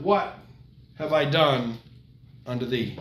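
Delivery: soft and fading, almost a sigh; soft lament. Acted. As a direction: let the question die away in sorrow. God's lament voiced softly.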